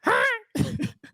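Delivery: very high squeaky imitation; high, pinched mock squeak. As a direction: squeal it through the nose. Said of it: "He has this, like, squeaky voice".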